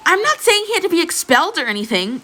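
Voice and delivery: high-pitched shout at a rival. A narrator's reading. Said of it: "Uraraka shouted".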